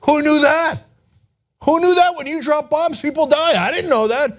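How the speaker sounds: high mock-innocent surprise at the obvious; mock clueless Trump. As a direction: play a dimwit amazed by the obvious. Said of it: "is like".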